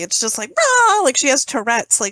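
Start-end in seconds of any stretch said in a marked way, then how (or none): 0.6-1.0 sudden loud, high yelp; uncalm mock scream. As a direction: blurt out a scream like an uncontrollable outburst. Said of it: "it's not, like, calmly".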